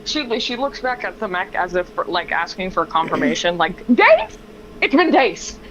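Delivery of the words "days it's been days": high incredulous cry of Days; shocked disbelief. Acted. Estrella turns to the mech, high and incredulous. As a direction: gasp it out in disbelief.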